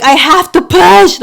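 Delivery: high, loud, slow desperate yell; screaming at nurse. Retold or imitated. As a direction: yell it at the nurse in labor pain. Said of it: "I was screaming at her".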